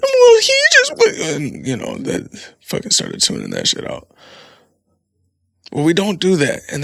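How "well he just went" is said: very high and loud mock child whining; mock kid excuse. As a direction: squeak it like a kid making excuses. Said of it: "And then shit".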